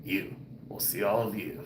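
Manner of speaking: put-on velvet late-night host, murmured; velvety chest voice. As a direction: croon a soft sign-off from your chest. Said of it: "Let me talk from my chest".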